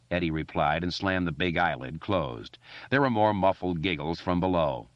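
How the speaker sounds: ordinary delivery, nothing marked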